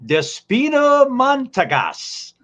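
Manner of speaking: slow, high, loud name announcement; ring announcer. Pretend. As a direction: draw the name out like a ring introduction. Announces the next wrestler's name.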